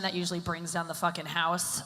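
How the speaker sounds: ordinary delivery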